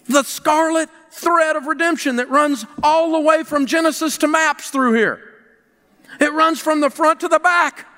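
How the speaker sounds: high, intense preacher's cadence; impassioned preaching. Acted. Pastor urging his congregation to give, in impassioned preaching. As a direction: preach it with full conviction.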